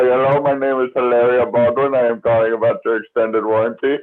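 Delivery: put-on Indian accent. Prank caller pitching an extended warranty, mock Indian accent. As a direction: imitate a telemarketer with an Indian accent. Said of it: "in an Indian accent".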